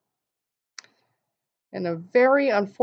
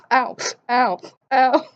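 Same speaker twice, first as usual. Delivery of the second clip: mock yelps of pain; 6 semitones higher, 5 dB louder than usual. Grabbing hot cookies from oven.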